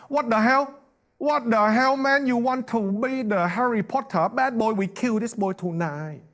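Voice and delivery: strict immigrant dad. Retold or imitated. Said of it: "I just changed the way I sound".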